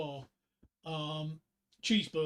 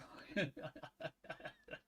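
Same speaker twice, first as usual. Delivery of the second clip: soft, breathy chuckle; quiet laugh to yourself; 6 dB quieter than usual. Real, not acted. Streamer waiting for requests, soft chuckle.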